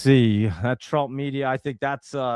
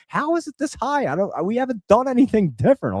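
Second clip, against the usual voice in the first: much higher, strained disbelief; exasperated disbelief; 10 semitones higher than usual. Retold or imitated. Streamer reenacting opening his huge bill, high exasperated disbelief. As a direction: squeak it out in disbelief.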